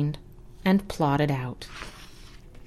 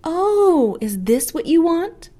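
much higher louder librarian voice; helpful librarian; 11 semitones higher, 5 dB louder than usual. Acted. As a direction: ask it brightly as the librarian. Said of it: "asked the librarian".

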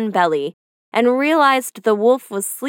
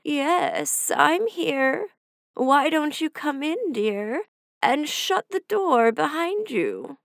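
higher, softer, slow sly granny voice; a wolf faking an old woman, quiet and slow; wolf posing as grandmother; 5 semitones higher, 5 dB quieter, slower than usual. Acted. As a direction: lure her in gently, disguised. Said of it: "a voice said".

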